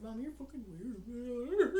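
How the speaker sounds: put-on laughing teen son, slow; son teasing. Teen son teasing mom, slow laughing mock. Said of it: "and he's like".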